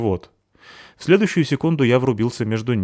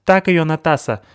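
cheering; 8 semitones higher, 6 dB louder than usual. Acted. Сыкиль подбадривает из угла.

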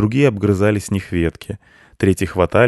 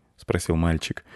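ordinary delivery, nothing marked

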